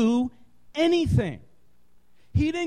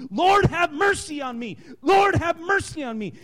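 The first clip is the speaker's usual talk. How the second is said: pleading shout; 5 semitones higher than usual. Retold or imitated. Blind beggar calling to Jesus by the road, pleading shout. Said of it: "crying out at the top of his lungs".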